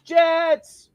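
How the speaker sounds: loud, high call; mock gang shout. Answering a rival gang in West Side Story, high shout. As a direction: shout it out like a battle cry.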